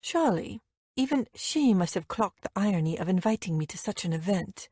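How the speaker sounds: plain reading voice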